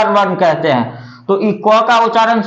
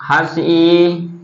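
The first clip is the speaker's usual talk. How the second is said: slow listing; 5 semitones lower, much slower than usual. Shown as a demonstration. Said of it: "अ".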